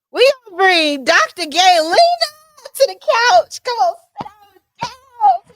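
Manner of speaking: loud, high, cheering the guest in; excited welcome. Real, not acted. Host welcoming Doctor Gaylena to the couch, squealing. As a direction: hype her up onto the couch.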